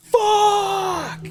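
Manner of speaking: high, drawn-out mock yell; yelled curse. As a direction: shout it from the back of the bar. Guy in the back yelling.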